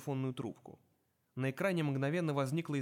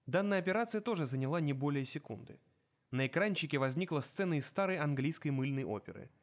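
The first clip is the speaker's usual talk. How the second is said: usual speaking voice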